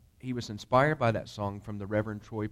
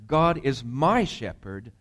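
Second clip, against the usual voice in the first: preaching affirmation; 7 dB louder, slower than usual. Acted.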